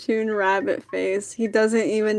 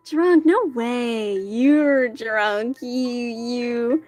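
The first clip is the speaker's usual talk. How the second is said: put-on lightly slurred, drawn-out drunken protest; a touch of tipsy disbelief; playful drunk; much slower than usual. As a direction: tease like someone tipsy. Acting drunk character arguing.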